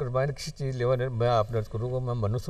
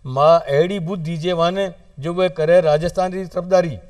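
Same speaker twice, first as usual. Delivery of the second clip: rhythmic recitation, each line declaimed and repeated; verse prayer; 6 dB louder, slower than usual. Acted. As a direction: plead with the goddess in measured, ringing verse. (वाणी री महाराणी सूं अरदास, लय में कविता पाठ)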